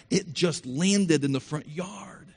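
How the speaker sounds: ordinary talk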